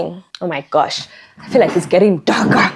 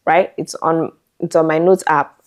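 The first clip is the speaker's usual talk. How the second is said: usual speaking voice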